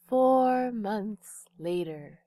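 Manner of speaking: slow, drawn-out title-card voice; fancy voice. Pretend. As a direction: stretch it out like an on-screen caption. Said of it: "I have to use my fancy voice".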